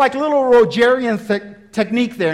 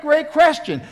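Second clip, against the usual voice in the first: ordinary talk, nothing marked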